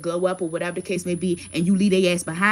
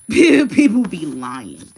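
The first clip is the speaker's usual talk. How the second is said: loud, drawn-out laughing repeat; real laugh breaking into loud, slow words; laughing emphasis; 10 dB louder, much slower than usual. Real, not acted. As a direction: burst out laughing and stretch it right out.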